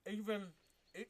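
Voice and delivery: talking with mouth full. Real, not acted. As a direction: say it through a mouthful of food. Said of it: "let me not talk with my mouth full".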